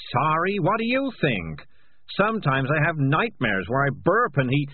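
loud, high, agitated cat confessing; frantic, guilt-ridden cat rant; guilty cat's outburst. Acted. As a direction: blurt it all out defensively, pitch climbing. Morris's agitated outburst continues.

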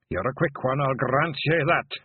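a touch of a weary Scots elder; old Feegle bard. A narrator's reading. William replies.

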